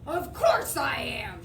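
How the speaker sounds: snapped. A narrator's reading. Cynthia snaps at the boy over being a girl. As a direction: bite off the words, offended. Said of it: "she snapped".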